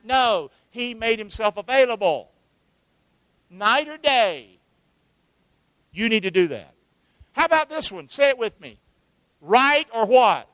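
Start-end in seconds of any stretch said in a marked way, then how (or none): none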